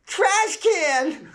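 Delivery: put-on very high, loud shriek of a small child; kid yell. Four-year-old answering mother, high loud yell. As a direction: shriek it gleefully.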